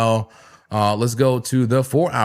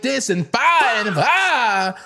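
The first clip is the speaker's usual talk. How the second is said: stream yelling; 11 semitones higher, slower than usual. Shown as a demonstration. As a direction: yell "five and five" like mid-stream. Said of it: "yelling like".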